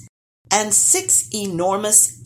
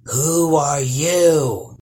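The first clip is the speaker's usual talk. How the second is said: low, slow and loud troll demanding; a big troll barking a question; 3 semitones lower, 5 dB louder, much slower than usual. Acted. Troll voice in story.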